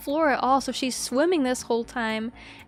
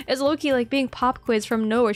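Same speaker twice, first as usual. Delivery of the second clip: ordinary delivery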